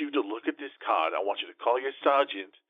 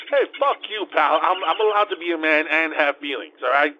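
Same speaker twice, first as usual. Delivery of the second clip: loud, higher angry retort; louder offended outburst; 4 semitones higher, 6 dB louder than usual. Real, not acted. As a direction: snap back, louder. Angry at mocking friend.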